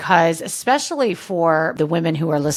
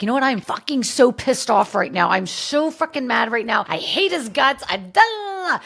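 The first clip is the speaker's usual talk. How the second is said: higher, hammed-up angry rant; mock venting rage; 7 semitones higher than usual. Woman venting about her ex in a higher, mock-furious rant. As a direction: let it rip like a furious ex-wife, half in jest. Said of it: "it's okay to be like".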